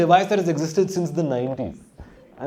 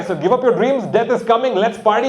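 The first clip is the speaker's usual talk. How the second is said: punchy slogan, loud and high; 6 semitones higher, 3 dB louder than usual. Shown as a demonstration. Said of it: "my three-point propaganda platform".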